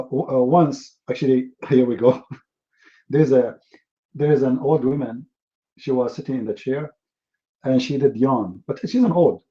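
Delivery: ordinary delivery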